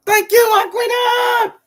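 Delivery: high, slow, loud sung-out thanks; shouted sing-song thanks. Real, not acted. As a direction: belt the thanks up high like a hype call.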